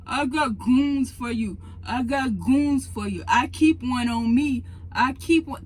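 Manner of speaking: a hint of a mocking thug voice; mocking tough guy. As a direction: parrot his threat back with scorn. Mocking the coworker's threat.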